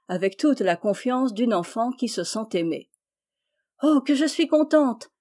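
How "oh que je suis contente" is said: delighted outburst, pitched higher; joyful cry. A narrator's reading. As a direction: burst out with happiness at his return. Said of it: "s'écria-t-elle".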